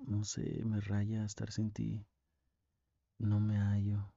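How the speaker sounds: usual speaking voice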